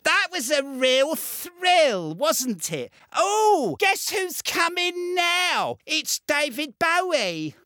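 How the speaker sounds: a lightly voiced languid rock star. Impression of [name].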